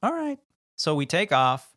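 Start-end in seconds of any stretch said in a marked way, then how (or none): 0.0-0.4 very high mock okay; high squeal. Real, not acted. Exaggerated high exclamation.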